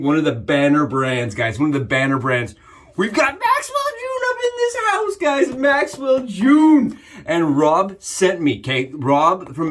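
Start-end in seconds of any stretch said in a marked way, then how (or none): 3.0-7.0 excited. Real, not acted. Hyping the brand name.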